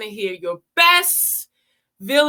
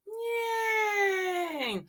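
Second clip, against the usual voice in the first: cheer; 9 semitones higher, 6 dB quieter than usual. Acted. Cheering after exercise.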